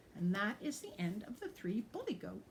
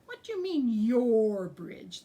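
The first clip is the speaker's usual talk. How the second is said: bully goat; 3 semitones higher, 9 dB louder than usual. A narrator's reading. Bully goat challenges the ogre.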